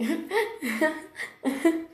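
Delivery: rising giggly laugh; giggling laugh. Pretend. Warrior cat laughing with Alex over tasty mice, rising giggle. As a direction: let the giggle climb up. Laughs after "It's funny, right?.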